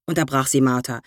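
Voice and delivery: plain reading voice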